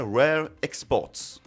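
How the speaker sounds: self-mocking English accent on "Rare Exports"; French speaker's mock English accent. Shown as a demonstration. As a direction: say "Rare Exports" like an English title, a bit overdone. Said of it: "avec mon superbe accent anglais".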